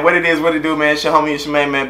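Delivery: ordinary talk, nothing marked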